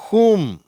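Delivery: ஹூம் high and loud, starting on h; H sound demo. Shown as a demonstration. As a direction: model WHOM as HOOM. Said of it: "ஹ் என்ற உச்சரிப்பு".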